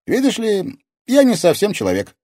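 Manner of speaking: put-on high, loud teenage boy; lively, high-pitched lad; boyish character voice. A narrator's reading. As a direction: explain brightly in a ringing boy's voice. Cheerful boy keeps explaining himself.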